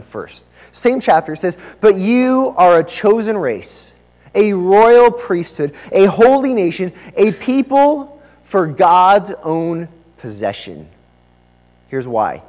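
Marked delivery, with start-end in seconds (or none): 1.8-10.9 slow, loud, emphatic verse reading; scripture reading. Acted. Preacher reads verse nine to the church, emphatic scripture reading. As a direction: proclaim each title with weight, slowly.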